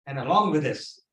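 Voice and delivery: usual speaking voice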